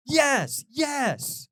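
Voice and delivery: high, loud, emphatic cries; fervent cheering yes. Acted. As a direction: shout it like a fan who's overcome. Cheering on sad country songs.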